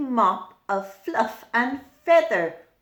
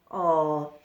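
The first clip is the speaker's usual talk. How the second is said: ordinary delivery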